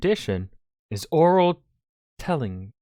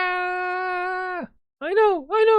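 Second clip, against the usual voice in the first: put-on crying girl; much slower than usual. Cohost voicing the sacrificed girl, high mock sob. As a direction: squeal it like a wailing child.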